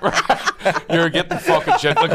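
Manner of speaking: ordinary talk, nothing marked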